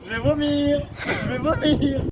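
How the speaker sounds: high panicked screams; screaming. Real, not acted. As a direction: shriek it out in real fright. Screaming on a ride.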